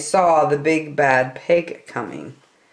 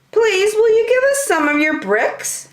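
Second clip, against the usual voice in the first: much higher, pleading little wolf voices; little wolves. Acted. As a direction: ask sweetly in tiny voices. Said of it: "asked the three little wolves".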